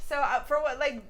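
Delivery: ordinary delivery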